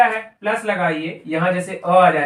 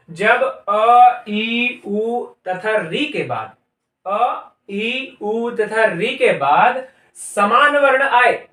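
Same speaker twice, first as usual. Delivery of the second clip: slow dictation; 3 semitones higher, 4 dB louder, much slower than usual. Shown as a demonstration. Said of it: "teacher dictates rule slowly".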